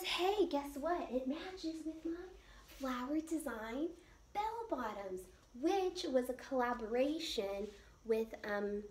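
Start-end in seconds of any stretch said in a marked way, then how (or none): 0.0-5.2 drops to a soft, half-whispered aside; hushed, conspiratorial aside; confiding hush. Real, not acted. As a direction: lean in and let them in on a little secret. Sharing a secret match.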